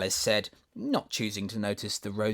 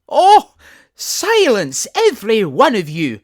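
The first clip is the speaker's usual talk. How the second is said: very high, loud, slow command for silence; angry cry; 13 semitones higher, 17 dB louder, slower than usual. A narrator's reading. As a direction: shout the daisies down. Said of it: "cried the tiger lily".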